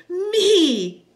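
put-on very high, loud little-girl yell; small girl's triumphant, high shout; child's yell. A narrator's reading. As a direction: yell it out in glee. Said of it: "Bea yelled".

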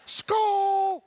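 high mock Viking chant; Vikings cheer. Joking about Minnesota Vikings, mock cheer. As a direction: toss out a football cheer.

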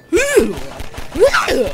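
high, loud mock "ooh"; re-enacted startled yelp. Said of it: "I was like".

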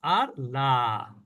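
slow, low, thin লা; light la. Shown as a demonstration. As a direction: stretch out a thin লা. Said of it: "যেমন আমি যদি বলি".

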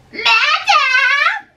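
high, loud shrill call of the nurse from afar; the nurse yells for her mistress; offstage nurse calling. Acted.

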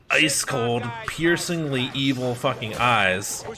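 normal talking voice

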